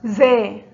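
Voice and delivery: loud, clear announcement of زا; loud teacher naming the letter زا; letter announced. Shown as a demonstration. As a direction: call out زا to the class. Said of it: "حرف الزاي محرك بحركة الفتح".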